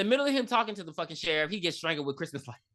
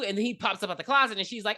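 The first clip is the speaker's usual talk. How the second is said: ordinary delivery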